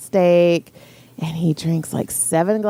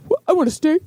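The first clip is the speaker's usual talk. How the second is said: put-on much higher whiny kid voice; whiny hungry kid; 12 semitones higher than usual. Hungry son begging mom for a steak, whiny. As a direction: whine for food like a starving teen.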